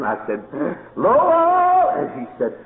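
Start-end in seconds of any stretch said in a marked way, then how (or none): 1.0-1.9 high, loud call of a name; sharp call. Retold or imitated. Preacher calls sharply to his dog, high and loud.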